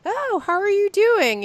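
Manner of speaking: high, slow, sing-song friend asking; mock concerned friend. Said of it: "your friends are like".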